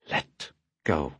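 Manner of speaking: quiet, held-back warning with an edge; tightly controlled. Acted. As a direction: keep the anger barely contained. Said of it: "her voice tightly controlled".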